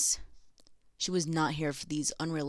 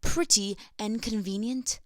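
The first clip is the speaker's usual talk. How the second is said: lightly put-on British accent, a little higher; 4 semitones higher than usual. Reading the pamphlet in a British accent. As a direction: read it like a proper British lady. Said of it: "I'm gonna say this in a British accent".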